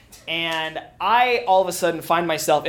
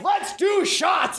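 excited shout; 14 semitones higher, slower than usual. Retold or imitated. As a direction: bellow it like a party call. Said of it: "And he's like".

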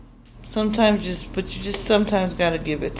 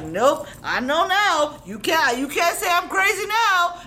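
defiant retort; 10 semitones higher than usual. Retold or imitated. Mom scolds husband during fight.

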